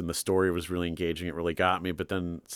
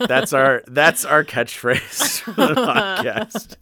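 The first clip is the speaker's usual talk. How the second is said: laughing through the words, much higher and louder; 8 semitones higher, 9 dB louder, much slower than usual. Real, not acted. Joking that a rude line is the podcast catchphrase, while laughing. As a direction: giggle out the words as the joke lands.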